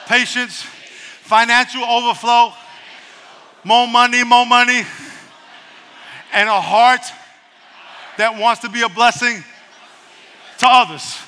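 loud, high preacher's exhortation; preaching. Acted. Pastor exhorts the congregation, loud and high. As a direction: preach it out to the church.

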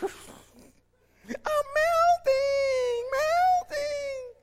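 very high, drawn-out wailing; melting witch impression. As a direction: wail like the Oz witch dissolving. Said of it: "on the Wizard of Oz".

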